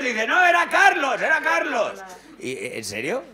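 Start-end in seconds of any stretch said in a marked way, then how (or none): 0.3-2.0 high-pitched mock insistence; insistent protest. Carlists insisting who the legitimate king was, insistent. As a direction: protest in a much higher voice.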